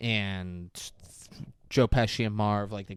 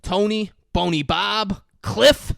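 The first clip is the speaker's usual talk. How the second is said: slow list of names rising higher and louder; accusing roll call; 8 semitones higher, 7 dB louder, slower than usual. Retold or imitated. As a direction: reel off each name, topping out high on the last. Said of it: "he's like".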